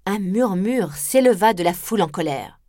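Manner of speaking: plain reading voice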